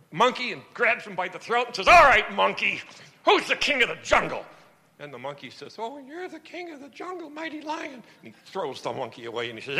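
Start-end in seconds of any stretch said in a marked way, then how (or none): 1.9-4.4 loud, growling jungle-king demand; bullying lion. Acted. Lion bullies monkey in joke. 5.8-8.0 much higher and softer, cowering; timid monkey. Acted. Scared monkey answers lion.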